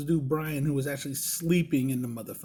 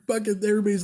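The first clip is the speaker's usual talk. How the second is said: normal talking voice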